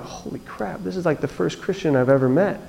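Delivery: retold under-the-breath awe; hushed amazement. Front-row listener stunned by the speaker, hushed awe. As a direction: drop to a near-murmur of amazement.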